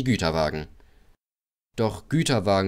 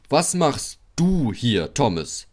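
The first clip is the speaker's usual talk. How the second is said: stern; 3 semitones higher, slower than usual. A narrator's reading. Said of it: "fragte er streng".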